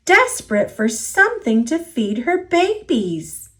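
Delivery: ordinary delivery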